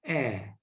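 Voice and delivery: A said as open, low-pitched "eh"; open È sound. Shown as a demonstration. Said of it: "Quite similar to like this".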